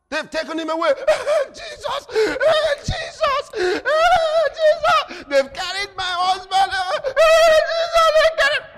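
high, loud hammed-up wailing; wife wails that her husband was taken, hammed-up; mock wailing wife. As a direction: cry out like a frantic wife. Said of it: "mimicking wife wailing".

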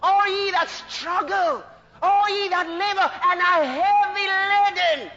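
high, stretched-out preacher's call; full-force preaching. Acted. Preacher voicing Jesus calling the burdened, high ringing call.

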